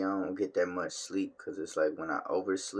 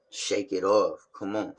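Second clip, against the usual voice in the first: louder self-rally; pump-up; 3 semitones higher, 8 dB louder than usual. Real, not acted. Vlogger rallying himself loudly after yawning. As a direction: psych yourself up out loud.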